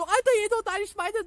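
hoch und schnell, gespielt warnend; warnende Stimme. Retold or imitated. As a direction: als genervter Kumpel hastig warnen. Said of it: "so nach Motto". (hoch und schnell gespielte Warnung)

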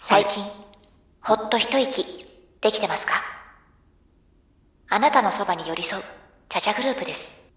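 calm tea commercial voiceover; soft commercial read. Shown as a demonstration. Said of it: "もう一回、テイクツー。".

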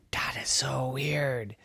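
slow, whispered wonder; thinking to herself. Acted. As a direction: whisper it slowly as a private thought. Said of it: "she thought in her head".

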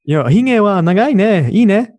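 high, sugary passive-aggressive praise; fake-compliment the beard sweetly; polite Japanese coworker. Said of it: "they're like".

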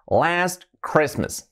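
"Last Christmas" said very slowly, every sound clear; careful English pronunciation. Shown as a demonstration. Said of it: "vamos a pronunciarlo correctamente".

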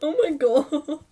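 high squealing laugh into oh my God; can't hold back the laughter. Real, not acted. Dean laughing at host.

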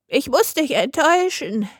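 put-on high-pitched old lady voice. A narrator's reading. Grandmother lets the wolf down, in a high old-lady voice. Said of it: "merkte die alte Dame an".

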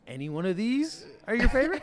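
high, quick teasing question; mock question. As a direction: fish for a compliment in a high voice. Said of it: "I'm like".

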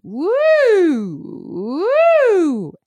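speechy ooh swooping up and down; siren. Shown as a demonstration. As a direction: slide high and back down. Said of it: "we just make it speechy".

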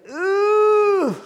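shouted high-pitched irritated ooh; a sudden loud squeal of irritation; annoyed high ooh. Retold or imitated. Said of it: "something in Paul that was like".

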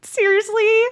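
high, gushing fake-friendly girl; annoying voice. Greeting podcast co-host Jill, in a put-on annoying voice. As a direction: pitch it way up and gush irritatingly. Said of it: "That's a good annoying".

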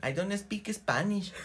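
mock Mexican-American. Mexican-American tourist brushing off Spanish, mock high slow English. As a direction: play the snooty tourist who denies Spanish.

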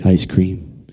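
a hint of a hammed-up believer; mock prayer. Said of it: "We look at the ice cream and say".

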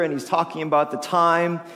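usual speaking voice

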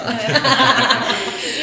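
bursting out laughing; big real laugh; loud laugh. Real, not acted. Laughing after joke about Lud.

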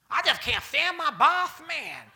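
high, loud put-on whine; silly complainer voice. Preacher mocking a believer griping about the boss. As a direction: ham up a dumb complaint. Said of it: "how dumb you sound".

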